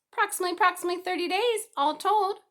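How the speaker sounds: high, loud, fast goose chatter. A narrator's reading. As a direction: rattle it off like a fussy goose.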